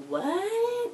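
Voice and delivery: a sudden high, stunned "What?"; shocked what. Retold or imitated. Vlogger retelling her shock at the twist, high and stunned. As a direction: jump way up in pitch, caught off guard.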